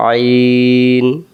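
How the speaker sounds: আইন drawn out slowly, pressed from mid-throat; a long, strained আইন; throat-pressed ain. Shown as a demonstration. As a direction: squeeze আইন from the middle of the throat, long and slow. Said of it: "এটার নাম".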